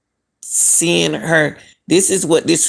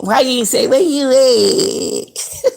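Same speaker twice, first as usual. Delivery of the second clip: high, very slow mock plea; drawn-out mock question; 8 semitones higher, slower than usual. Mocking the friend's silence, slow high drawn-out question. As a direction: stretch the question out in disbelief.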